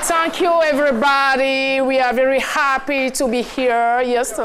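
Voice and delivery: put-on showy English, Ivorian French accent, half-shouted. Host greets the audience in English, Ivorian French accent. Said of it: "Vous n'êtes pas amoureux là de mon accent?".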